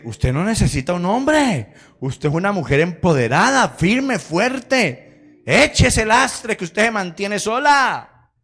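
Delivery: loud, high, drawn-out rallying cry; rally her with stretched, rising indignation; hammed-up feminist friend. Feminist friend urges the tired wife to leave, hammed-up rallying.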